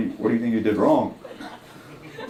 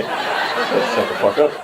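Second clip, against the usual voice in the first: slow retort; much slower than usual. Retold or imitated. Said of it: "retold retort".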